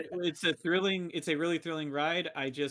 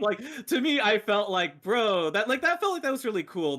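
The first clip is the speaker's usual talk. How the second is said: excited; 6 semitones higher, 5 dB louder than usual. Real, not acted. Excited movie praise.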